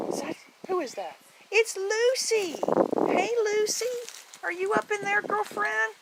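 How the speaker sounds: high sing-song calls up to the cat; high pet-talk calling. Real, not acted.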